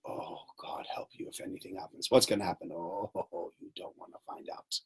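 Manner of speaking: whispered, low menacing hint; creepy hushed warning; ominous whisper. Pretend. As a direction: whisper it like a dark secret. Ominous unspecified threat.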